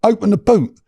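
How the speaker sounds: why didn't you just say so; 1 exasperated relief. Retold or imitated. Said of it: "So I'm like".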